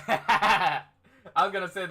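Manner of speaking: cracking up while talking; can't keep straight face. Real, not acted. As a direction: try to speak through the laugh. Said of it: "I can't say that with a straight face".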